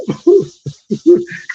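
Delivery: sudden high burst of laughter; real high-pitched cackle. Real, not acted. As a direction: crack up laughing. Laughing at his own joke.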